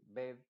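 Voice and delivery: "bed" said with a plain Spanish e, like "bet". Shown as a demonstration. Teacher demonstrating "bed" with a Spanish e. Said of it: "lo pronunciaríamos nosotros en español".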